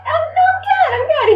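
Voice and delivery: very high squeaky nervous brush-off; mock nervous squeak; high nervous dodge. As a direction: squeak out that you're fine. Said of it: "I was like".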